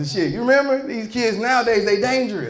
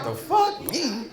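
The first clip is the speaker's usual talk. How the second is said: mock disbelief; 4 semitones higher, 6 dB quieter, much slower than usual. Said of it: "I'm like".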